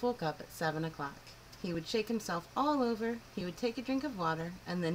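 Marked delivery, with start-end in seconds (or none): none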